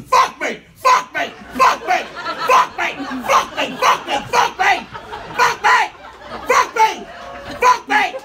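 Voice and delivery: imitated angry screech, high and loud; pissed-off screaming. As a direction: scream it in repeated, punched bursts. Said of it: "just screaming at me".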